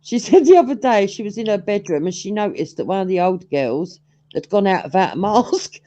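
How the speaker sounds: ordinary talk, nothing marked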